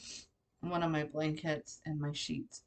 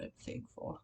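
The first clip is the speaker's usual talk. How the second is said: tearful whisper; 9 dB quieter than usual. Real, not acted. Tearful vlogger thanking God.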